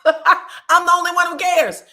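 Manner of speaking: high, loud mock suck-up; eager son. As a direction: crow it like the favorite child. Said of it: "you hear Miles say".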